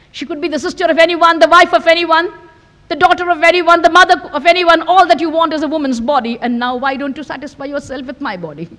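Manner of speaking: high, insistent list building then easing; heated challenge. Acted. Sister challenging her lustful brother, heated and insistent.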